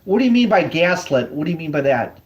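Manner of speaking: ordinary talk, nothing marked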